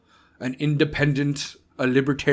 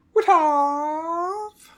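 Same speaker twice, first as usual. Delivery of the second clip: high, drawn-out disbelieving what; startled; 11 semitones higher than usual. Real, not acted. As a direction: sputter in disbelief. Startled exclamation.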